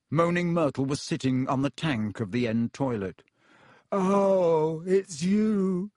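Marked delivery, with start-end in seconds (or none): 3.9-5.9 put-on high, whiny ghost girl voice; sulky ghost girl, higher and louder.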